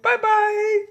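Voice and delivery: put-on very high, singing-out child's farewell; kids waving goodbye. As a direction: call goodbye like a little kid. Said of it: "and they wave".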